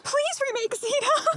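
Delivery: high, drawn-out fan plea; playful begging. Pretend. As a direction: plead slowly in a high, excited squeal. Fan jokingly begs for a remake.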